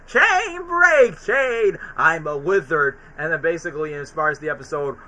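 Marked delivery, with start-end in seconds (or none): 0.1-3.0 high dramatic chant, slowing to wizard; mock wizard Daffy. Daffy declaring himself a wizard in jail, mock proclamation. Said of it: "he goes".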